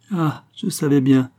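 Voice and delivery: a touch of tender emotion; murmured with emotion. A narrator's reading. Old ragpicker finds her boy unchanged, moved murmur. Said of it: "murmura-t-elle avec émotion".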